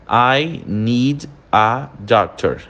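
slow, each word set apart: I - need - a - doctor; pausado. Shown as a demonstration. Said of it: "quando a gente fala essa frase pausada". (pausado, palavra por palavra)

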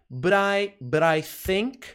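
"but I" linked as "buh-rye"; slow "but I think" with the t tapped as r; linked pronunciation. Shown as a demonstration. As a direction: join "but I" smoothly before think. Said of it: "vai soar como but I".

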